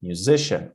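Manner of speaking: muSIcian, stress on the middle syllable; musician with the middle syllable strong; correct stress. Shown as a demonstration. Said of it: "the same as musician".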